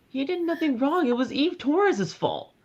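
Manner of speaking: hammed-up whiny defence; mock indignant.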